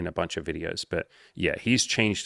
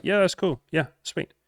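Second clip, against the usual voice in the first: much higher, easygoing and unbothered; breezy casual; 10 semitones higher than usual. Retold or imitated. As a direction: toss it off lightly, no big deal. Casual reply to woman declining.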